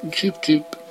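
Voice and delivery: high-pitched chick cheeping; short, high bird chirp; mocking chirps. A narrator's reading. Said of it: "gúnyosan csipogtak".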